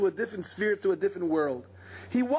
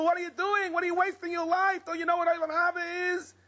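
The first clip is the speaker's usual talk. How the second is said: higher, scolding cry at the people; angry rebuke; 9 semitones higher than usual. Retold or imitated. Rabbi Akiva rebukes the people, mock-angry and higher. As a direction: shake them out of wasting their lives. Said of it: "and he's so angry".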